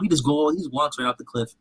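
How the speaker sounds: ordinary talk, nothing marked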